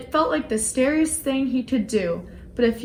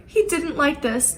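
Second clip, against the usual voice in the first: plain reading voice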